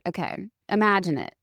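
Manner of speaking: usual speaking voice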